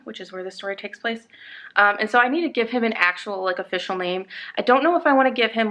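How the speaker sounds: ordinary talk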